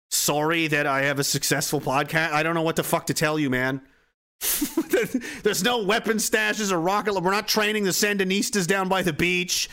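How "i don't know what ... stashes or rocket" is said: ordinary talk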